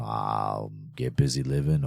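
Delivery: a deep, slow imitation of Morgan Freeman. Shown as a demonstration. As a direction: drop the voice way down and drawl it out like a movie narrator. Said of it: "I can say it with a deep voice".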